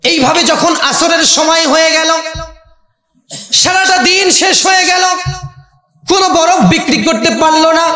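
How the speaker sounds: high, sustained sermon chant; melodic preaching. Real, not acted. Preacher telling villagers of the ice seller's failed day, melodic preaching.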